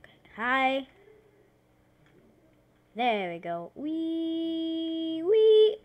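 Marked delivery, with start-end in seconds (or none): 3.7-5.8 high, loud gleeful squeal; excited wee. Real, not acted. Gamer sliding down in the game, gleeful squeal. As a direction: let out a joyful whee.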